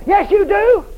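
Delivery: high loud snap back; loud shouted contradiction; shouted retort. Real, not acted. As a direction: fire it back at them. Preacher shouting rebuttal.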